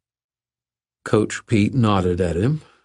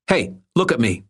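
higher-pitched, restrained snarl; narrated snarl; 5 semitones higher than usual. A narrator's reading. Irwin confronting a bully, lightly snarled. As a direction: snarl it lightly, demanding attention. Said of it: "Irwin snarled".

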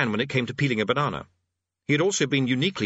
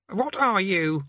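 high-pitched, demanding question; challenging mystery voice; 9 semitones higher, slower than usual. Acted.

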